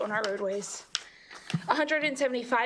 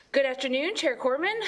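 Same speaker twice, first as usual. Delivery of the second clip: ordinary talk, nothing marked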